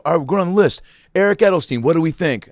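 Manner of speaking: mock-officially opening the floor on a candidate; frat meeting leader. Frat leader running down the new pledges, lightly acted announcing voice. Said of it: "brotherhood meetings where they would break down".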